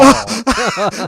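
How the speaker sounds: squeaky burst of laughter; laughing. Real, not acted. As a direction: laugh out high. Laugh at owl fact.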